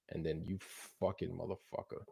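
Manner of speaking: muttered curse. Real, not acted. Picture jumps back, swears.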